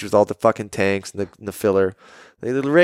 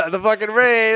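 gleeful Dagger; 9 semitones higher, slower than usual. Retold or imitated.

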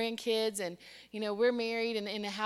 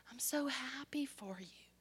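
whispered, heartfelt, imitating a moved aunt; hushed tenderness; 3 semitones higher, 10 dB quieter than usual. Crying aunt hugging her niece, tender whisper. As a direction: whisper it softly, as if choked up.

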